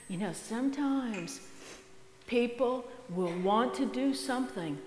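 usual speaking voice

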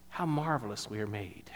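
quiet wonder. Retold or imitated. Recalls thought in wheelchair.

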